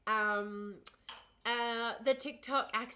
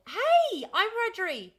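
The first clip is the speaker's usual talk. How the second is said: high, loud, delighted shout-out; real burst of excitement, pitched way up; excited greeting; 10 semitones higher, 11 dB louder, much slower than usual. Real, not acted. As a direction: call out a thrilled hello. Greeting a fellow Wiradjuri viewer.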